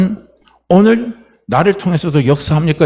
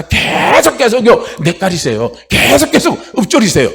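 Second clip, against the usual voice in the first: pitched-up rallying cry to keep chanting; urgent high call; 6 semitones higher, 3 dB louder than usual. Real, not acted. Preacher urging the flock to keep chanting, high rallying call. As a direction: rouse the congregation, high and urgent.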